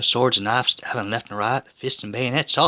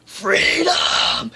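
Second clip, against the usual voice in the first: "Freedom!" yelled, much higher; a battle cry as he leaps out; yelling; 13 semitones higher than usual. Acted. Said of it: "redeems himself by yelling".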